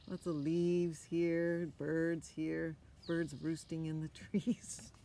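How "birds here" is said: ordinary delivery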